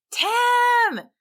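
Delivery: high, loud call across the lunch area. A narrator's reading. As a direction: shout his name to get his attention. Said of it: "his brother called".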